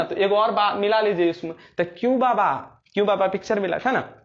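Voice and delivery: ordinary talk, nothing marked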